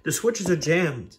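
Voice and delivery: high, loud and slow warning; alarmed signalman. Acted. Signalman warns Mike the switches are jammed, loud. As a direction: call out the bad news urgently.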